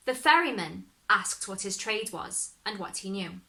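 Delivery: ordinary delivery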